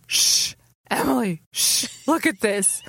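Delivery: mock-secretive drunk shushing; hammed-up drunk stage whisper, still voiced; whisper slurred. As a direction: shush like a tipsy conspirator. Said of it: "leaned over and whisper slurred".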